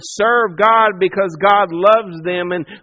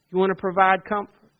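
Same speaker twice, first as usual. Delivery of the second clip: usual speaking voice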